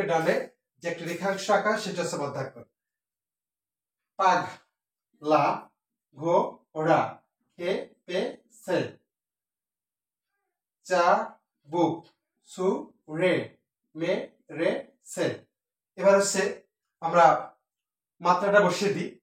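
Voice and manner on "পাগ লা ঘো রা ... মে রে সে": "পাগ, লা, ঘো, রা" each syllable said alone with pauses. Shown as a demonstration. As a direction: tap out each syllable slowly. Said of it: "counting syllables". (অক্ষর ভেঙে ভেঙে বলা)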